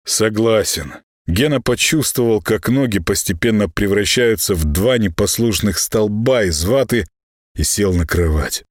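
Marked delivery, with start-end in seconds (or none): none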